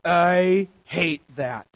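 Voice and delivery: imitated student, slowly and deliberately; drawn-out conviction. Youth leader mimicking a student finding their burden, slow conviction. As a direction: stretch each word out, acting a sudden realization.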